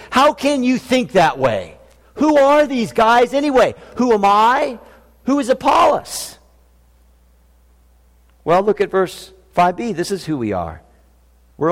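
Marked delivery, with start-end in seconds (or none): 0.1-6.4 scornful, rising higher toward the end; contempt and disdain. Retold or imitated. Preacher voicing Paul's scorn for leader worship, rising higher with disdain. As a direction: sneer at the idea of celebrity leaders. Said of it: "He's expressing contempt and disdain".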